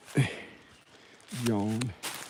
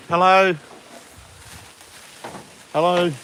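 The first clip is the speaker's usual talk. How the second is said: loud, higher hello; raised, carrying greeting; calling out; 6 semitones higher, 4 dB louder than usual. Real, not acted. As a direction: call down to whoever is there. Calling out to animal down there.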